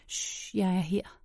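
soft shush, then gentle reassurance; quiet, tender murmur; soothing hush. Acted. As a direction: hush and comfort someone after a nightmare. Soothing him after nightmare.

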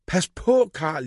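whiny wail that jumps high on pas; little brother wailing a warning. A narrator's reading. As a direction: Max wailing, high then fading. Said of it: "jamrede Max".

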